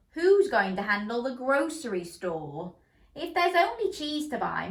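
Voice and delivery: usual speaking voice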